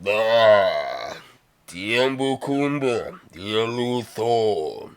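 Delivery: slow, drawn-out alien speech; Jabba speaking Huttese. Acted. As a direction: stretch each syllable like a huge crime lord.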